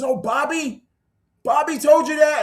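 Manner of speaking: amazed he knows Bobby; high surprised Bobby told you that. Retold or imitated. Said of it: "he says".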